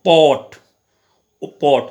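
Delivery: पॉट punched loud on its rounded ओ; teacher demonstrating a vowel; short o sound. Shown as a demonstration. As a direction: hit the ओ in पॉट. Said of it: "पॉट को sound है ये".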